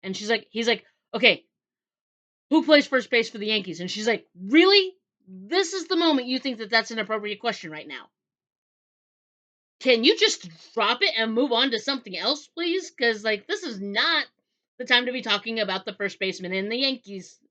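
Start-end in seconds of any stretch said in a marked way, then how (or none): 1.1-3.8 loud, higher retold quizzing; insistent demand. Man quizzing her at the worst moment, loudly insistent. 4.4-17.4 high, loud retold protest; exasperated disbelief. She objects to his badly timed question, exasperated.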